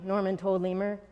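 ordinary delivery

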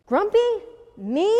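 loud, high, incredulous rise; indignant question. A narrator's reading. Jim answers Norman calling him grumpy, indignant. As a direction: throw the word back as a question.

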